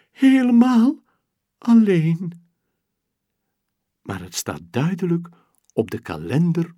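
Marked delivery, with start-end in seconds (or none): 0.1-2.5 high, loud, drawn wail; mock-sad wail; lonely wail. Lonely girl laments.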